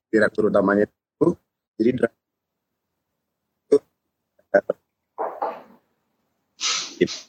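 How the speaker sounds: glitchy dropouts between words; choppy cutting out audio. Real, not acted. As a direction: voice breaking up on bad connection. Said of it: "gue suara lu putus-putus".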